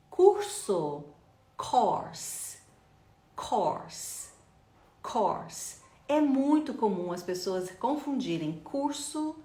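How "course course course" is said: "course" with open aw as in or; isolated, careful "course", falling each time; repeat-after-me model. Shown as a demonstration. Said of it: "vai repetir comigo agora".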